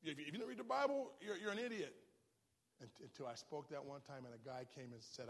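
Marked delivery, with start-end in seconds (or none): 0.0-2.0 fast, higher, louder mock preacher rant; a quick, raised mock of a preacher calling people idiots; mocking faith preacher. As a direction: ham up a scornful eighties faith preacher. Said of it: "stupid statements I heard faith preachers make".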